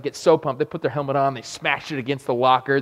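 ordinary delivery

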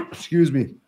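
throat-clearing cough through the words; rough voice after choking on smoke; choking on vape. Real, not acted. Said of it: "I'm choking on my vape here".

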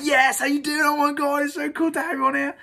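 high, loud starstruck greeting; excited gush. Retold or imitated. Greeting his marketing hero on the call, high excited gush. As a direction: gush like meeting your idol.

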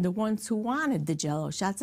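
ordinary talk

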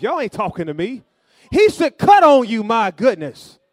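loud, high-pitched sermon shout; full-force preaching. Real, not acted. Preacher telling the church they should feel a cut, full-force preaching. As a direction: drive each point home at full volume.

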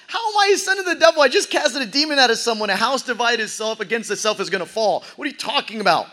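high, fast, baffled protest; Jesus can't believe what he's hearing; incredulous outburst. Acted. Jesus protests to accusers, incredulous and high.

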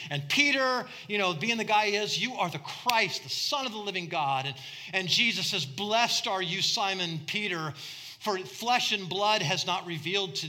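loud, high animated storytelling; preaching at full force. Acted. Preacher voicing Jesus and Peter, loud and high. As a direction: act out the disciples with big energy.

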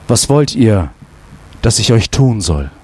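low, gentle, unhurried question; tender and loving. A narrator's reading. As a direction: ask like a king bending kindly to his children. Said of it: "Wie zart, wie liebevoll".

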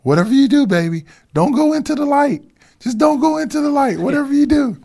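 mother begging her son in hospital, high imitating voice; imitating his mom. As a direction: plead like a frightened mother at the bedside. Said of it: "my mom goes".

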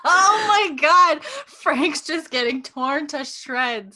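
high squeal of laughter, drawn out; helpless high, slow laughing exclamation; laughing hard. Real, not acted. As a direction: gasp it out slowly through high-pitched laughter. Cracking up at co-host's roast.

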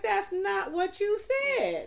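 high, slow mocking of husband. Wife mimicking her husband's pedantic reply, slow and high. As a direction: drag it out like a smug husband. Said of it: "And he's like".

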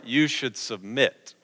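ordinary talk

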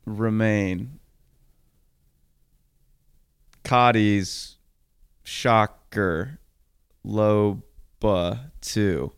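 slow, drawn-out poetic reading, long pauses between phrases; slow haiku recital. Pretend. Reciting his haiku to his co-host, slow and drawn out. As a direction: recite it like a solemn poem, word by word. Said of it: "That's my haiku".

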